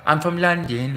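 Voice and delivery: a hint of sweet, innocent Londoner; British accent. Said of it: "like, a British sister".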